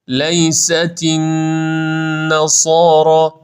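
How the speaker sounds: نصارا slow, nun held for two beats; two-beat stretch. Shown as a demonstration. Teacher modelling the two-beat stretch. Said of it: "به اندازهٔ دو حرکت کشش".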